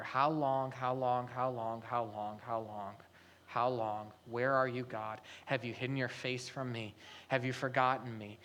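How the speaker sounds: a weary cry to God that sinks to almost nothing; lamenting prayer. Acted.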